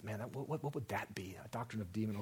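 soft, half-whispered mock wondering; hushed puzzlement. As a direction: wonder under your breath, acting baffled. Acting out puzzled listeners pondering doctrine.

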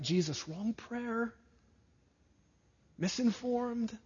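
a small, pleading objection to Jesus; mock protest. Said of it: "My flesh cries out".

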